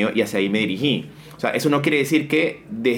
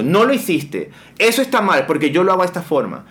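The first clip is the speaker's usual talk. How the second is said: higher, pressing supervisor's reprimand; fault-finding boss; 6 semitones higher, 3 dB louder than usual. Acted. Supervisor faulting an employee's work, reprimanding. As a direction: scold like a boss checking up on staff. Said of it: "yo fiscalizo".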